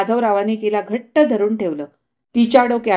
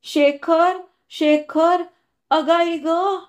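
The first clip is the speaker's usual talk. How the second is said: higher, broken sobbing wail of a name; sobbing cry; 7 semitones higher, much slower than usual. Acted. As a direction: cry out between sobs, gently acted. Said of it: "ती अनावर हुंदक्यातून म्हणाली".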